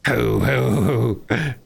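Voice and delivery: gleeful laugh; self-admiring chortle. A narrator's reading. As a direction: chortle at your own cleverness. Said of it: "he cried, in ecstasies of self-admiration".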